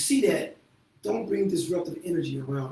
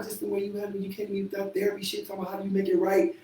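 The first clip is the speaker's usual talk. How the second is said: ordinary talk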